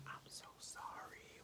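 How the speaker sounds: soft breathy whisper, almost no voice; whispered apology. Retold or imitated. Boy whispering an apology to the cashier, hushed. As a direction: whisper sorry to the cashier behind dad's back.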